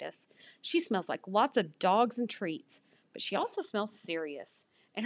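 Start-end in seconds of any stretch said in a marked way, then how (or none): none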